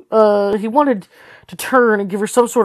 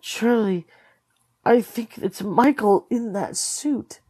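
softer, slower hushed confession; dropped to a whisper; 2 semitones higher, 6 dB quieter, slower than usual. A narrator's reading. Carlton telling Charlie a dreadful secret, hushed. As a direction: hush it as if afraid to be heard. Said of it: "his voice dropped to a whisper".

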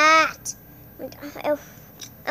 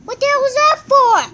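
much higher, louder and fast yell; high angry yell; high indignant shout; 8 semitones higher, 5 dB louder, faster than usual. Real, not acted. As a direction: yell it in disbelief.

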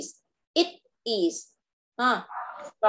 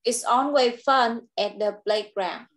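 "It's always fun at the playground" said slowly and clearly, each word separate; slow model reading; slower than usual. Shown as a demonstration. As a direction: read it slowly for the child to copy. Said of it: "từ này điền là từ fun".

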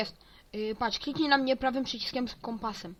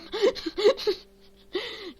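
high squealing laugh, loud; 12 semitones higher, 7 dB louder than usual. Real, not acted. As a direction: burst into a high-pitched cackle. Laughing after falling off the map.

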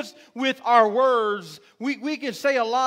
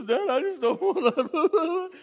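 hammed-up higher-pitched laughing wail; mock laugh-crying; 5 semitones higher than usual. Father imitating his stressed-out daughter over an exam, mock laugh-cry. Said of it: "she, she laugh cries".

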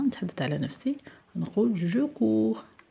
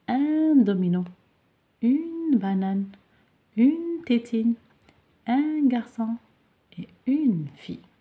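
each article UN or UNE stressed, higher and louder; emphatic model answers; 4 semitones higher, 4 dB louder, much slower than usual. Shown as a demonstration. Teacher reading out the answers to the class, stressing each article. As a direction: read out the answers, hitting every article. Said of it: "راني حليت".

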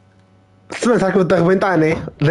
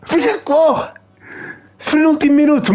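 much higher, raging shout; furious outburst; 11 semitones higher than usual. Real, not acted. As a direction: explode at the last-minute goal. Conceding a last-minute goal.

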